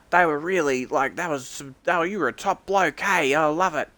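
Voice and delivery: high, hammed-up ocker Aussie voice; mock broad Aussie accent. Streamer mocking game voices in an exaggerated Aussie accent. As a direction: lay the Aussie on thick, like a top bloke. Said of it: "not an Australian accent".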